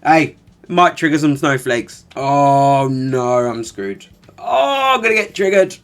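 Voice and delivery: 1 mock panic. Mocking snowflakes being triggered.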